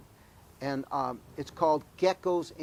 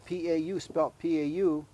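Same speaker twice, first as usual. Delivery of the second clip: usual speaking voice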